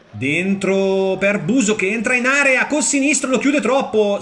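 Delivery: high, mounting burst of commentary; excited match call. Real, not acted. Commentator calls the striker bursting into the area, excited. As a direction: pitch climbing as the chance builds, then missed.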